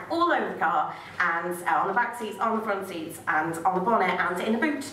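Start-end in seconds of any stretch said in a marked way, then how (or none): none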